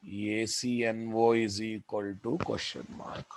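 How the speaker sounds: ordinary talk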